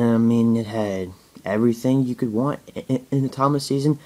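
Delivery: ordinary delivery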